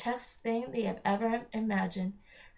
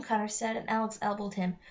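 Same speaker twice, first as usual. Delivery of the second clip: plain reading voice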